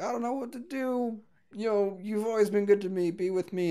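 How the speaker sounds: half-hearted soft plea; mock pleading. Retelling a character's plea to her.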